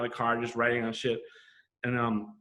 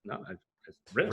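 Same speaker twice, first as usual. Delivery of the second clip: sheepish; 3 semitones lower, 5 dB quieter, much faster than usual. Retold or imitated. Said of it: "I'm like".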